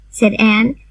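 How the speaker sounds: usual speaking voice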